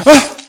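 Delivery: short, high, loud shriek; sudden cry of terror as fangs strike; terrified scream. Acted. Victim attacked by a vampire, terrified scream.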